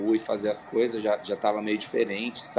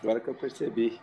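hoarse from yelling; 5 dB quieter, faster than usual. Real, not acted. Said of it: "tô rouco".

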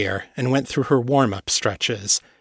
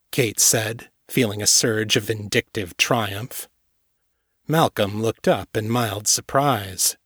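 usual speaking voice

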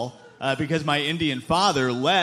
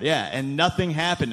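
usual speaking voice

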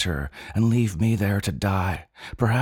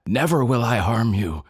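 higher and louder, a booming vow; bellow; 5 semitones higher, 4 dB louder than usual. A narrator's reading. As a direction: bellow the promise with full force. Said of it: "I bellow".

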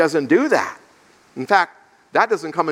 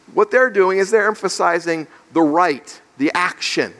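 normal talking voice

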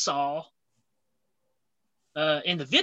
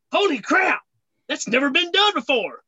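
amazed; 7 semitones higher than usual. Acted. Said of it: "everybody's like".

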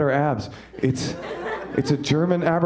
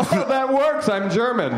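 hammed-up cheery, much higher and brighter; mock his breezy pride in being German; jovial; 10 semitones higher than usual. Comic mocking the German guy's answer, extra jovial. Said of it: "don't be that jovial about it".

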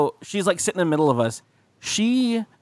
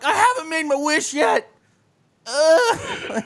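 high, mock desperate kid; whiny child protest pitched way up, ending in a groan; Ralphie; 12 semitones higher, slower than usual. Boy being kicked down the slide, high mock protest. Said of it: "in A Christmas Story where Ralphie's getting kicked".

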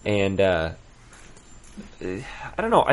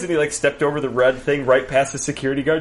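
ordinary talk, nothing marked

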